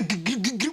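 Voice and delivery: loud, high stuttered punching noises; imitated flurry of blows. Imitates the fight breaking out.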